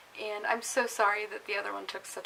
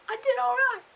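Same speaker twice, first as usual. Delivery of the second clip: excited; 7 dB louder than usual. Real, not acted. Proud about her result.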